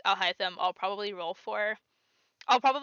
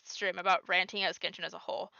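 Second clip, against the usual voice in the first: usual speaking voice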